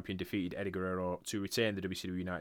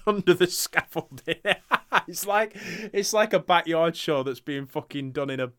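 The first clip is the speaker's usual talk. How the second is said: laughing; 8 semitones higher, 7 dB louder than usual. Real, not acted. Laughing at the scaffolding entrance.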